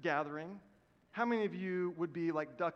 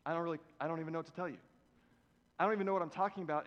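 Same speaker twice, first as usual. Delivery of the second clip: fast, tumbling self-mocking ramble; rambling aside; much faster than usual. Real, not acted. Preacher joking about his own confusion, rushing the words. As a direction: rush through it, laughing at yourself. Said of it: "you're confused now too".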